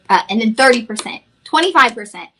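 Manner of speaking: ordinary delivery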